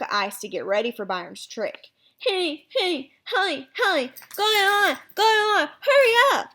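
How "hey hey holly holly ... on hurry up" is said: high, garbled frantic yell; muffled panic, rising to a loud squeal; mouth stuck. Acted. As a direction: yell for help without closing your lips. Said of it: "sounding like he had something in his mouth".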